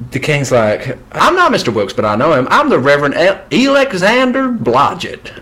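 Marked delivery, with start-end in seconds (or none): 1.1-5.4 higher and louder grand swindler; pompous conman king. Acted. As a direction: puff yourself up as a fake reverend. King voiced in retold story.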